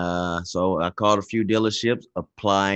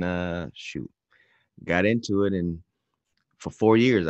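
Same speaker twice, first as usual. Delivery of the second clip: usual speaking voice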